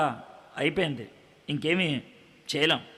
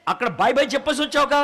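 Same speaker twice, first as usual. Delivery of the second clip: surprised questioning; 8 semitones higher, 5 dB louder, faster than usual. Acted. Interviewer astonished by her plan.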